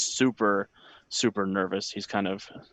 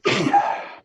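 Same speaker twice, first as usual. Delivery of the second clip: choked sob; 10 semitones higher, 5 dB louder than usual. Acted. Boy breaks down.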